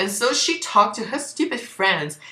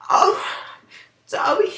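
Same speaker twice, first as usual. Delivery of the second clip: high, breathless swoon over Dobby; swooning gasp; 13 semitones higher than usual. Acted. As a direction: sigh his name like a lovestruck fan. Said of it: "she said".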